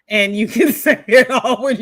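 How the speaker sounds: laughing through the words. Real, not acted. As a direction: giggle as you finish the line. Laughs mid-sentence.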